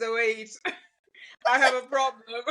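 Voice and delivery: laughing through the words; amused at herself, giggling. Real, not acted. Realizing her mimicking habit, laughing.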